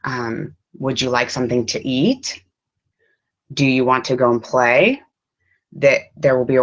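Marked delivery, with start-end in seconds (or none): 0.8-5.0 rising tune on "eat" and "play"; question intonation. Shown as a demonstration. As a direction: end of each question rising up. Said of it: "question intonation will, will rise".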